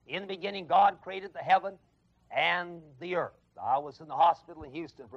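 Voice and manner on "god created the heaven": ordinary delivery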